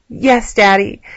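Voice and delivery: usual speaking voice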